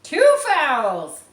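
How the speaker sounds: loud, high scream. Acted. Mary calling a foul on Harry, screaming. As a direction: scream it across the field. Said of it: "Mary screamed".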